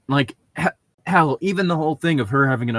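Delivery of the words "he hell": ordinary talk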